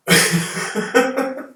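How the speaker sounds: laugh. Real, not acted. Laughs again.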